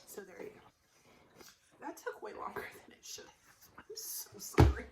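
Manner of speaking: soft unvoiced apology; embarrassed whisper; whispered aside. Real, not acted. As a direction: whisper it sheepishly.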